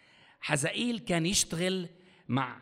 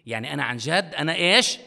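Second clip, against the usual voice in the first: ordinary delivery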